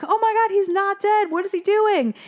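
high-pitched, squealing disbelief all through; mock astonishment. Fish seeing Nemo wink, high astonished squeal. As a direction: exclaim it like a flabbergasted onlooker.

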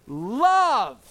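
loud drawn "Love" to the congregation. Shown as a demonstration. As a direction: call it out for all to repeat. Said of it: "Say it out loud with me".

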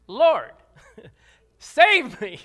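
loud, urgent cry for help; desperate cry. Shown as a demonstration. Preacher acts out a drowning man's quick prayer, loudly desperate. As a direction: shout it like you're going under.

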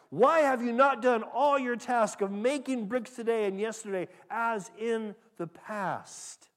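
loud, high accusing question; harsh demand. Acted. Taskmasters interrogating the beaten foremen, loud and harsh. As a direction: demand answers from the beaten men.

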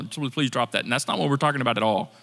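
ordinary talk, nothing marked

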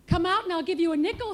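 put-on fast, loud, high-pitched boy's yell; urgent kid shouting an offer. A narrator's reading. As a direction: holler it quickly at the baby. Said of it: "he yelled".

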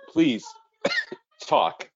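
words squeezed out between choking coughs; choking on a drink. Real, not acted. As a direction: still choking, push out a plea. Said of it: "I'm choking now on my water".

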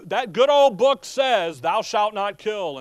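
a hint of a scolding zealot; mock preachy quote. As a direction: ham up the self-righteous preacher. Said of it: "they say".

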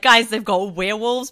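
loud mock announcement to the housemates; retold breathless announcement. Reader running inside to tell flatmates, loud retold outburst. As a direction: burst in with absurd book news. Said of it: "Being like, running inside".

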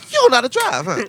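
high, loud motherly jab; his mom's voice. Retold or imitated. Imitating his mom teasing the girl about driving. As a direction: put on your mom scolding a bad driver. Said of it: "my mom, like".

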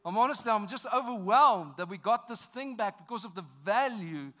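emotional preaching. Acted. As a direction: pour your heart out to the church. Preacher full force emotional.

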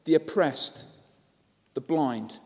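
ordinary talk

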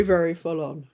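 usual speaking voice

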